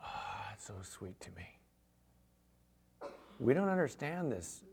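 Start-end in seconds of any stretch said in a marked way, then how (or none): 0.0-1.6 breathy whisper, savouring; God whispering contentedly over a gift; whispered delight. Retold or imitated. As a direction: whisper it like smelling flowers. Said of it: "God just goes".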